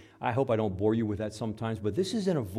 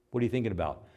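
ordinary delivery

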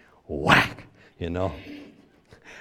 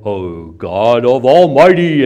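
mock preacher praying, much louder and slow; hammed-up holier-than-thou prayer; pompous prayer voice; 2 semitones higher, 15 dB louder, slower than usual. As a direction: boom it out like a showy pulpit prayer. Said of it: "where did that voice come from".

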